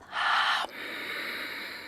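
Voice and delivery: closed-mouth ocean breath from the throat; soft throat-constricted exhale through nose; Ujjayi breath. Shown as a demonstration. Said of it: "This is called Ujjayi Pranayama".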